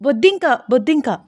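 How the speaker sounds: high, halting, stumbling over the words; flustered stammering. A narrator's reading. Sita covering up her scream before the elders, stammering. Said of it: "అని తడబడుతూ మాట్లాడుతోంది సీత".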